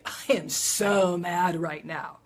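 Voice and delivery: loud, drawn-out stress on "so"; demonstrated anger, loud and emphatic; controlled anger. Shown as a demonstration. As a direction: vent it firmly without lashing out. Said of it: "Without, you know, being tough or mean".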